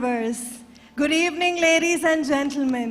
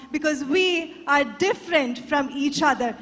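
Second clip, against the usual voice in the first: ordinary talk, nothing marked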